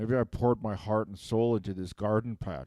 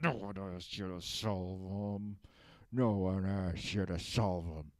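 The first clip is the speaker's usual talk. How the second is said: quiet slow repeat; 4 semitones lower, 7 dB quieter than usual. Acted. Villain repeats his grievance, muttering.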